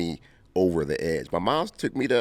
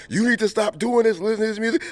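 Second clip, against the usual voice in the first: high, strained voice of the praying church ladies; church folks scolding. Retold or imitated. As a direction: scold the kid as if casting out the devil. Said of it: "They're like".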